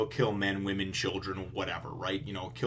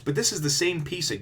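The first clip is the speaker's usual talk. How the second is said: ordinary talk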